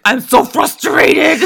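hammed-up cry of frustration, stretched out; mock frustrated outburst. Co-host acting out the boyfriend's reply to her, mock frustrated outburst.

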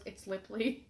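soft, low deadpan quote; imitated deadpan. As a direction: drop it quietly, dead serious. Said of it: "looks me dead in the face".

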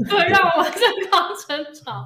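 laughing, high and loud. Real, not acted. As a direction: laugh the words out. Repeats joke, laughing.

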